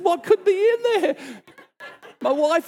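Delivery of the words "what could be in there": incredulous question. Retold or imitated. Security flags his bag.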